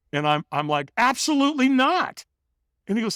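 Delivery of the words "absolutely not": high-pitched mock refusal; retold flat-out no, voice jumping high; emphatic refusal. Hider refuses the trainer's offer to walk, acted emphatic no.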